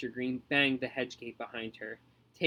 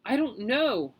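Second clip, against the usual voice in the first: wailing; 8 semitones higher, 4 dB louder, slower than usual. Acted. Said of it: "wailed Fetlock".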